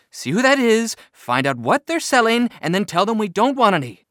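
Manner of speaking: high, loud, brisk mother; bossy mom. A narrator's reading. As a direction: fire off orders like an impatient mom. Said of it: "said Mom".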